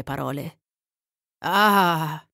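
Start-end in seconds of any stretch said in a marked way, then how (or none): 1.4-2.3 drawn-out, restrained cry. A narrator's reading. Man overcome by the girl's gaze, mildly crying out. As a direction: let out a heartfelt "ah". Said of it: "gridò Ringwood".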